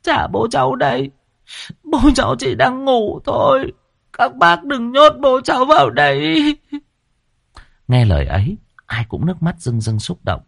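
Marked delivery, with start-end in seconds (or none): 0.0-6.6 put-on very high child's crying plea; high-pitched, grief-stricken daughter begging the grown-ups; tearful whimpering. A narrator's reading. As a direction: plead like a sobbing little girl. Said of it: "Đứa con gái cứ mếu máo".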